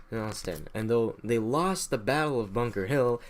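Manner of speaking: normal talking voice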